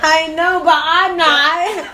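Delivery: high, loud, slow x0.5 giggling; cracking up while agreeing; laughing. Real, not acted. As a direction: stretch it out through laughter. Laughing back at teasing.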